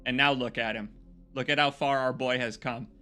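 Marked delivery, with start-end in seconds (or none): none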